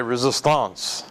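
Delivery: mock-French "resistance", ending drawn into "-ahnce"; French pronunciation. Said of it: "as the French would say".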